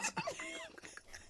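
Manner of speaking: high laugh. Real, not acted. Laughing at the caption.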